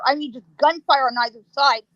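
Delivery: ordinary talk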